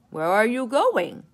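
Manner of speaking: loud called-out question; shouted question. A narrator's reading. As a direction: call it out across the field. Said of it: "shouted the farmers".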